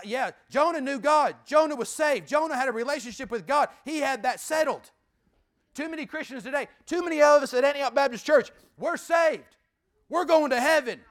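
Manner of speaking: loud, high, driving sermon cadence; preaching at full force. Acted. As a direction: hammer it home. Preaching climax.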